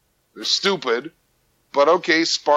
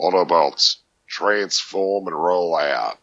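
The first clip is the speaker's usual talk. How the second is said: put-on slow, much lower Australian robot-hero accent; Aussie Optimus Prime; 7 semitones lower, slower than usual. Showing the Australian version of his robot role in Australian accent. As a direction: growl the battle cry in broad Australian accent. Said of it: "the Aussie version of, uh, Optimus Prime".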